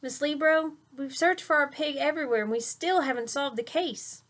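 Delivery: high, loud, hurried report; breathless report. Acted. Tyrone telling Miss Libro the search failed, breathless. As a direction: rush it out in a frustrated burst.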